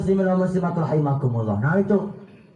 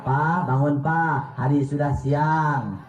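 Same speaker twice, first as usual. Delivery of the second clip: gentle wife; 6 semitones lower, slower than usual. Retold or imitated.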